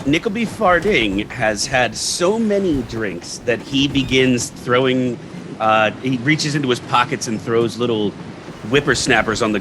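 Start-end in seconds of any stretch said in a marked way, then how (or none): none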